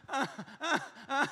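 high, hammed-up sobs; imitated heaving, tearful gasps; badly faked crying in short bursts; mock sobbing. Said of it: "I was ready to cry".

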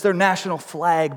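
usual speaking voice

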